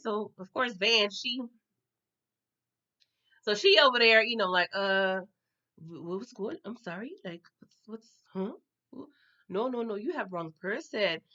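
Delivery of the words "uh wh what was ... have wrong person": hushed, stammering denial; feigning non-recognition. Retold or imitated. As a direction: play dumb, fumbling softly for words. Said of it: "Me acting like I don't know this girl".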